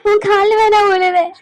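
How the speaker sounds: crying, pleading desperately; tearful begging. Acted. Grandmother begs Thatchayani not to send her away, crying. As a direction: beg through tears.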